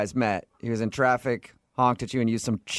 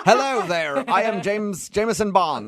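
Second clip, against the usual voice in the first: higher, hammed-up spy introduction; mock a bad secret-agent impression; James Bond; 5 semitones higher, slower than usual. Host mocking the caller's impressions, hammed-up spy voice. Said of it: "And James Bond, nothing changed at all".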